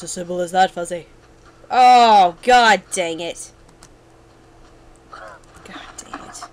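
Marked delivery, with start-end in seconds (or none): 1.7-3.5 loud exasperated curse, drawn out at the end; frustrated outburst. Real, not acted. Setback in the game. 5.1-6.4 slow, frustrated whisper; whispered curse. Real, not acted. Repeats curse under breath.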